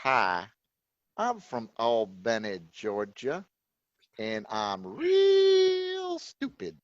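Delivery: slow, low, drawn-out Georgia drawl; hammed-up Georgia yokel, stretching the vowels; Georgia accent impression. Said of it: "your impression of people from Georgia".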